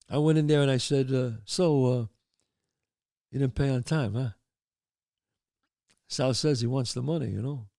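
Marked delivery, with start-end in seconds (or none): 1.5-7.7 lower, understated threat; soft-spoken menace. Acted. Mobster collecting overdue money at an audition, softly menacing. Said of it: "I played it softer".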